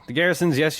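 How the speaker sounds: normal talking voice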